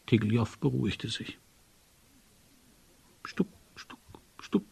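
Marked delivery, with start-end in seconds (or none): 3.2-4.6 soft, unvoiced knock syllables; hushed knocking noise; knocking imitation. A narrator's reading. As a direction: imitate three quiet raps. Narrator imitates the secret knocking.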